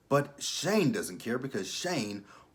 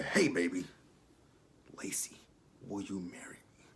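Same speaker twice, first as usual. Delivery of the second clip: hushed, whispery mock proposal; mock tender proposal; 2 semitones lower, 4 dB quieter than usual. Mock suitor proposing to Lacey, hushed tenderness. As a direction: drop to an intimate put-on whisper.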